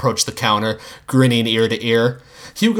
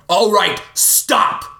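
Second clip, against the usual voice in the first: angry shout; 9 semitones higher, much slower than usual. Acted. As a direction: snap at everyone to freeze. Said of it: "he shouted with all the anger".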